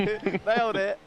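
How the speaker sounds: slow, high, drawn-out brag; triumphant gloat. Real, not acted. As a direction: stretch it out like a victory cheer. Triumphant gloat after correct guess.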